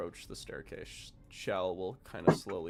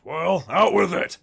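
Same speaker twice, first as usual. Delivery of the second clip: impatient shout; 8 semitones higher, 14 dB louder than usual. Acted. Troll NPC barking at party.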